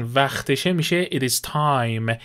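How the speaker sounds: normal talking voice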